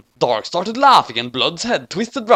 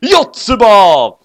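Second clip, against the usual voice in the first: dramatic exclamation; 4 semitones higher, 9 dB louder, much slower than usual. A narrator's reading.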